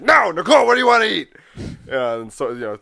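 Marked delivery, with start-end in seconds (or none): 0.0-1.3 high, loud frustrated mother; exasperated mom. Retold or imitated. Daughter imitating her mom's exasperated question, high and loud. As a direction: snap at the kid like a fed-up mom. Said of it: "my mom's like".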